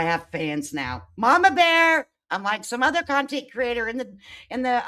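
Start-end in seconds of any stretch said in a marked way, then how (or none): 1.2-2.1 loud brag. Pretend. Boasting about her new fans.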